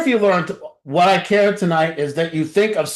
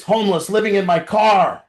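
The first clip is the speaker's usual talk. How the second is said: ordinary talk, nothing marked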